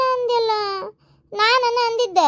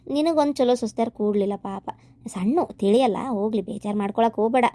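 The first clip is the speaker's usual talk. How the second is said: put-on old grandmother; 8 semitones lower than usual. Grandmother comforting the mother-in-law, low and weary. Elderly grandmother figure consoles.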